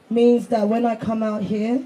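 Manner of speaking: usual speaking voice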